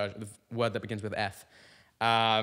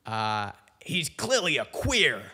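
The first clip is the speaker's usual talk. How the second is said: loud, higher, then slows down; heckler yelling; 4 semitones higher, 5 dB louder, slower than usual. Retold or imitated. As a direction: yell the accusation from the crowd. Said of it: "the guy in the audience yells out".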